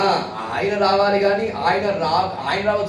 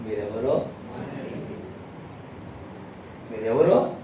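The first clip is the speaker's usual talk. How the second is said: merciless; 6 semitones lower, 8 dB quieter than usual. Acted. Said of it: "ప్రభు నిర్దాక్షిణ్యంగా అంటున్నాడు".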